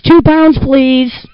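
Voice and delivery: put-on high, loud childlike voice asking; little girl voice. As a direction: squeak it out like a small girl who can't talk well. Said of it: "She says".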